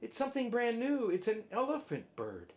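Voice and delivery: high, fast excited exclamation; high, quick amazed cry; excited crowd shout. A narrator's reading. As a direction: exclaim in astonishment. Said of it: "they shouted".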